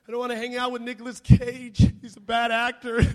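loud, hammed-up wail; mock weeping. Weeping boy complains to God about Nicolas Cage, mock wail. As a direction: wail it in fake tears, tipping into laughter. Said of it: "and I'm weeping".

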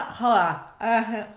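words muffled by teeth aligners; talking with aligners in. Real, not acted. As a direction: speak around aligners in the mouth. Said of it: "I have to take my teeth out".

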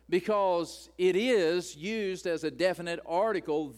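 normal talking voice

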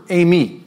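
eimi said as AY-mee, clear and careful; Greek word. Shown as a demonstration. Pastor teaching the Greek for I am, careful pronunciation. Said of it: "the way you say "I am" is one word".

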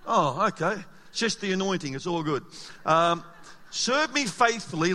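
usual speaking voice

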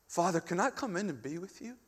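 soft, humble asking, rising at the end; gentle prayer. Acted. Believer asking the heavenly Father to come in, soft prayer. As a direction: ask quietly, as a child of God would. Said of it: "That's how we pray".

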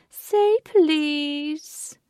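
high, loud, drawn-out singsong; taunting villain. A narrator's reading. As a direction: stretch out PLEASE to torment him. Villain repeats demand, drawn out.

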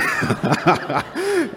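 big burst of real laughter; loud falling laugh. Real, not acted. Laughing at own joke.

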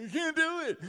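high, loud comic wail; mock crying. Preacher imitating himself crying to mom over times tables, mock crying. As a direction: wail it like an overwhelmed child. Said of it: "I remember crying at night".

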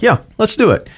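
high excited agreement to the recruiter; eager yes. Retold or imitated. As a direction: sign me up, said with a high eager lift. Retold eager sign-up to recruiter.

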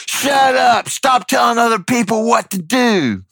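high, indignant imitated yell; mock yelling woman. Woman customer telling off the bystander, mock high-pitched yell. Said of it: "the woman starts yelling at him".

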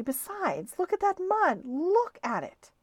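rising to a frantic yell over the mud; screaming. A narrator's reading. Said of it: "He was screaming now".

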